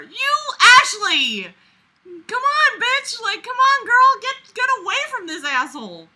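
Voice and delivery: high, loud egging on; yelling at the character to leave; hyped encouragement; cheering on. Real, not acted.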